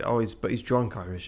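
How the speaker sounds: normal talking voice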